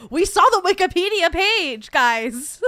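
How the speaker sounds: loud, high-pitched insisting; exasperated shout. Real, not acted. Streamer insisting to chat about Wikipedia, exasperated shout. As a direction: yell it in comic exasperation.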